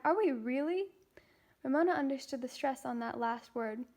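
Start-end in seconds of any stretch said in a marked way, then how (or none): 0.0-0.9 louder eager question, voice lifting; can't believe the good news; excited question. A narrator's reading. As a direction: Beezus hoping for her own room, eager and loud. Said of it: "she cried".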